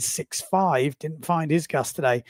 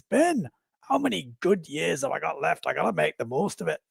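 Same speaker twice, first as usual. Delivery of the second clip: imitating a Scottish sailor; amateur Scottish accent, quoting a friend; best Scottish accent; 3 dB quieter than usual. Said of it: "That's my best Scottish accent".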